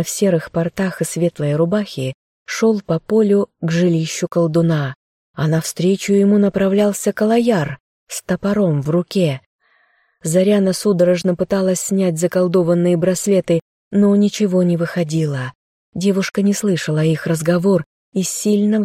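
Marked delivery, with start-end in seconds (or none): none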